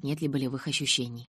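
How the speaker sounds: usual speaking voice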